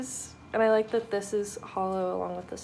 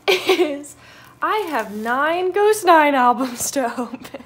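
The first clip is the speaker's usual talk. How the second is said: loud, higher announcement breaking into a laugh; 6 semitones higher, 10 dB louder, slower than usual. Real, not acted. Vlogger reveals absurd album haul to viewers, laughing. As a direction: laugh through the big reveal.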